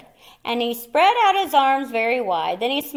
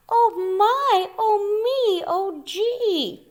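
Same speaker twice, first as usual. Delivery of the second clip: higher, fretful little cries; dismayed family; 10 semitones higher, slower than usual. Acted. The family frets at the empty corner, high and dismayed. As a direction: fret over the missing tree.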